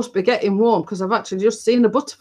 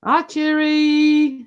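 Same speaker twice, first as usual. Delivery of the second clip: put-on slow baby talk; 7 semitones higher, much slower than usual. Greeting toddler slowly.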